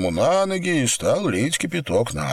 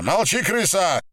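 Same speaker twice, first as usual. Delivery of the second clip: high-pitched angry order; angry command; 10 semitones higher than usual. Acted. Captor silences pleading woman, high angry command. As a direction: shut them up in a raised pitch.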